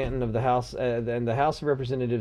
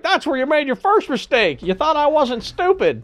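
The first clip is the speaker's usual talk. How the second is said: mock triumphant; 11 semitones higher, 5 dB louder than usual. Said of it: "I wanna be like".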